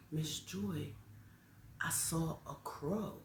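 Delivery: soft, slow, grave admission; hushed confession. Retold or imitated. Child tells Miss Joy about a crow, hushed. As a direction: imitate a child confiding softly.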